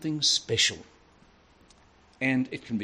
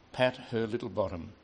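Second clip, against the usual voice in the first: ordinary delivery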